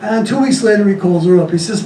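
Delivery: ordinary talk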